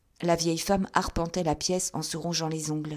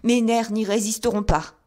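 high, loud terrified outcry; bursting in crying out in panic; frightened cry; 5 semitones higher, 7 dB louder than usual. A narrator's reading. Thaddeus runs out to Holmes, crying out in terror. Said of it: "cria-t-il".

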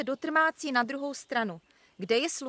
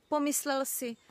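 plain reading voice

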